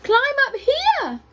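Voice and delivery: high monkey call. A narrator's reading. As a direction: call down to the animals from the treetops. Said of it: "called the monkeys from the treetops".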